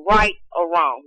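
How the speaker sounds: ordinary talk, nothing marked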